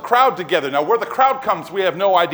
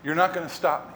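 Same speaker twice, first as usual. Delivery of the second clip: softly stated refusal; quiet resolve; 3 semitones lower, 6 dB quieter than usual. Retold or imitated. Jesus answering Peter's objection, quietly resolute.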